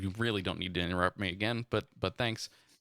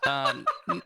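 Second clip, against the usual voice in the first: laughing. Real, not acted. Laughing at bee and squirrel.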